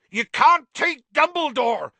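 high, full-force angry yell; furious shout. A narrator's reading. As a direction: bellow in outraged protest. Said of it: "yelled Hagrid".

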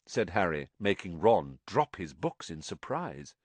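usual speaking voice

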